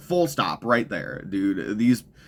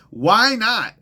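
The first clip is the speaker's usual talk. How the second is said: higher and louder mocking why not; sarcastic outburst; 9 semitones higher, 4 dB louder than usual. Real, not acted. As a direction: throw why not out high and loud, sneering. Sarcastic rhetorical question.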